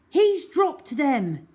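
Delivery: put-on boy crying out in dismay; cried out. A narrator's reading. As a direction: call out the bad news. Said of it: "cried Algy".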